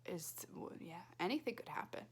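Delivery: spooky hushed question; suspense whisper. Acted. Teacher whispering to the class to build suspense.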